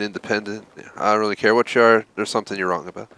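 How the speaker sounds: ordinary delivery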